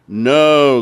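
loud emphatic no; crowd answer. A narrator's reading. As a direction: call it out as the people. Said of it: "they said".

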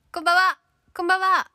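high, loud bright hello to new arrivals; loud happy greeting to the chat; excited greeting. Real, not acted. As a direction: call out a cheery good evening. Greeting viewers who just arrived.

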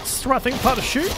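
high, loud anxious question; panicked. Real, not acted. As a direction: ask frantically mid-fight. Unsure where to shoot, panicking.

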